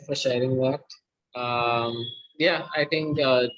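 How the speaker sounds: usual speaking voice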